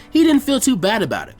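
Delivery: plain reading voice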